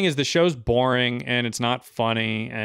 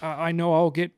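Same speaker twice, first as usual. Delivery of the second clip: higher, slow, timid put-on voice; meek mock comedian; 5 semitones higher than usual. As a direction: squeak it out like a shy comic. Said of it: "the kind of joke he would write".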